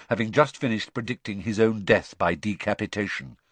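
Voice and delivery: ordinary delivery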